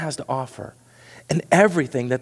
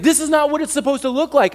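panicked disciples; 11 semitones higher, 4 dB louder than usual. Acted.

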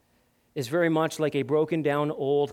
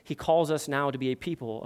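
ordinary delivery

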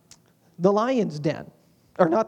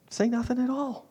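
usual speaking voice

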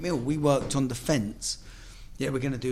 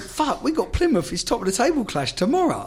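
much higher, louder exasperated outburst; retold panic; 9 semitones higher, 5 dB louder than usual. Injured player fretting about missing the Plymouth clash, higher and louder. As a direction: blurt it out high and loud, caught in a panic.

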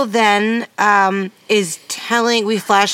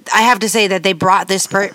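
normal talking voice

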